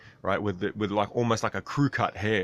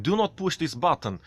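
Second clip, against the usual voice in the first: Russian instructor; 7 semitones higher than usual. Retold or imitated. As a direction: bark it like the Russian flight instructor. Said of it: "and he's like".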